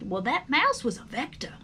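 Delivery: ordinary delivery, nothing marked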